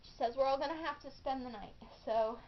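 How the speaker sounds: ordinary talk, nothing marked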